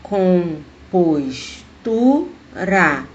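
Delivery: "Compostura" very slowly in syllables, TU lifted; stress on TU. Shown as a demonstration. Teacher showing the tonic syllable, "Compostura" split with stress on tu. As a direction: break up "Compostura" and lean on the syllable tu. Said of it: "a sílaba tônica é tu".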